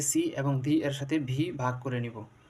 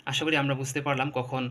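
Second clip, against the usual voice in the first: usual speaking voice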